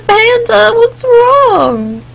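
high, slow singsong coaxing; hammed-up doting dad; concerned dad. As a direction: coo a pet name to an upset child. Dad consoles crying daughter.

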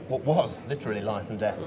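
usual speaking voice

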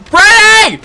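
high, loud calling out for Freddy; frantic call. Real, not acted. Gamer calling his companion Freddy for rescue. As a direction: yell for help.

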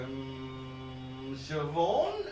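long unsure hum, then rising question; hesitant guess. Retold or imitated. Comic guesses which woman he is greeting, acted hesitant. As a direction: venture a name, unsure.

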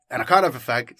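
ordinary delivery, nothing marked

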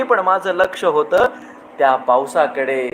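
lingering, lilting recital of verse; poem recitation. Acted. Host reciting a rain poem to his audience, lilting. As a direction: savour each line like falling rain.

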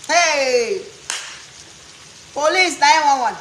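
loud alarmed shout for the police; mock kid shouting for the police; calling for police. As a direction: yell out for help. American kid calling police on parent.

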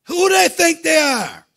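high, loud, driving sermon delivery; fiery preaching. Acted. Pastor rallying the church against Satan, in fiery full-force preaching. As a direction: preach it out hard to the congregation.

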